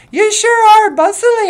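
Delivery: acted holler to a worker; shouted admiration. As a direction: call it over the noise. Shouting admiration over jackhammer.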